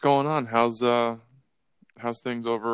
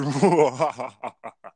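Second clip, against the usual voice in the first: evil villain laugh; 3 dB louder than usual. Acted.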